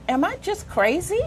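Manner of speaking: usual speaking voice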